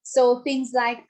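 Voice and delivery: ordinary talk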